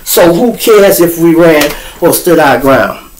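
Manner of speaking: ordinary talk, nothing marked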